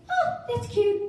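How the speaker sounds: high-pitched mimicked politeness; polite dismissal, imitated. Retold realtor's dismissive politeness.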